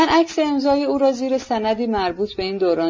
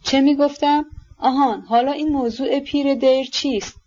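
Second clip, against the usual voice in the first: higher-pitched, mildly cross questioning; peevish grumbling; 5 semitones higher than usual. A narrator's reading. General's wife recalling her question, peevish grumbling. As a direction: grumble while trying to remember. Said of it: "با اوقات تلخ و غرغر کنان".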